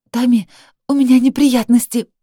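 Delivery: crying, high and loud; sobbing. Acted. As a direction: choke out through tears. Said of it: "Захлёбываясь слезами, реву в трубку".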